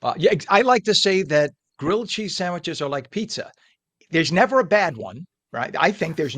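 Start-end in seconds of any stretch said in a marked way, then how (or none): none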